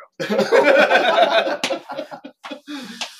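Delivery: big high burst of laughter; loud laugh. Real, not acted. Laughing at own hero joke.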